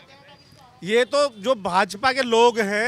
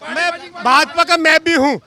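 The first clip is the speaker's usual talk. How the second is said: loud, higher-pitched insistence; angry; 3 semitones higher, 6 dB louder than usual. Real, not acted. Man insisting to reporter he is BJP too, angry and loud. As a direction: raise your voice in real indignation. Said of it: "[name] जी बहुत गुस्से में है".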